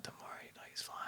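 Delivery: soft hushed reassurance; whisper. Retold or imitated. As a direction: whisper to calm the strangers.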